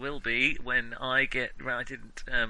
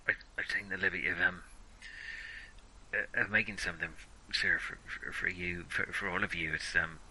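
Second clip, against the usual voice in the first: halting, hesitant offering to a superior; nervous subordinate; 6 semitones lower, 4 dB quieter than usual. Acted. Subordinate presenting something he made for his superiors, nervous and halting. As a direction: stammer softly through the offer, tripping over small words.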